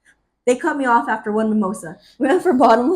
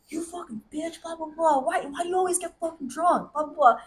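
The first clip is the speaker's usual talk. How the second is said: ordinary talk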